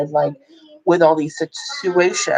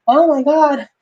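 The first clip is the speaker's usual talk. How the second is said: high, louder exclamation; retold amazement; 10 semitones higher, 5 dB louder than usual. Mother reliving her baby's birth, high retold amazement.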